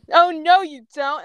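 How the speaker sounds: high, forceful interception; alarmed defiance. Acted. Ember blocks an attack.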